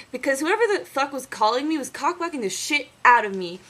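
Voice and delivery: ordinary talk, nothing marked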